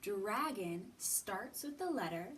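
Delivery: usual speaking voice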